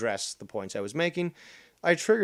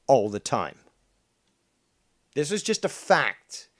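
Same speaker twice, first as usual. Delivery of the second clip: usual speaking voice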